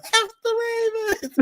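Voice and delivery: high, shrill-pitched mock yell of a rival fan; yelled team taunt. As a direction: scream it out the car window like a fan. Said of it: "She was talking about".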